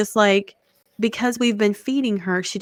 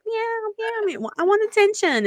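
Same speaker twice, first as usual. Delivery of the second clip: much higher demanding kitty voice; cat voice; 13 semitones higher than usual. Retold or imitated. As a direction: meow like a needy cat. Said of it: "she's like".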